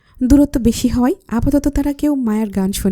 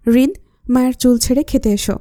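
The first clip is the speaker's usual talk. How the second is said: plain reading voice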